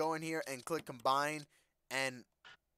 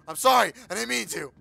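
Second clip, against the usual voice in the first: loud, higher hammed-up sorry; mock yelled sorry; 8 semitones higher, 14 dB louder than usual. Gamer blurting sorry after hitting the zombie, loud. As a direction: blurt the apology.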